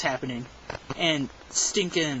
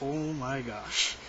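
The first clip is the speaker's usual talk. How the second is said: slow, drawn-out oh my gosh in disbelief; stunned oh my gosh; 6 dB quieter, much slower than usual. Retold or imitated. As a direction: stretch each word out, dumbfounded. Reacting to his hardcore rant.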